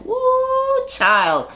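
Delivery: put-on sassy high swooping ooh, child; a high mock-scandalised ooh child. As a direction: ham up the shocked gasp at the insult. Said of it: "everybody's like".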